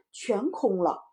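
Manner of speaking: plain reading voice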